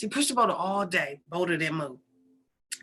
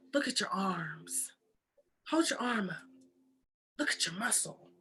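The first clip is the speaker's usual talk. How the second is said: very soft, near-whispered God voice; hushed revelation; 4 semitones lower, 9 dB quieter than usual. Acted. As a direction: breathe it quietly to him. God shows Paul his strength.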